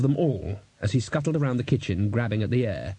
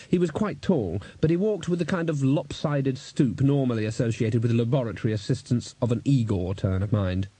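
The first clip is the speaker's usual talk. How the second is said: usual speaking voice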